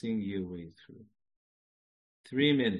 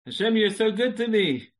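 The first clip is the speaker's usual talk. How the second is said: higher and louder; joyful exclamation; 9 semitones higher, 9 dB louder than usual. Shown as a demonstration. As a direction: burst out with thanks. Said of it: "Let me time that".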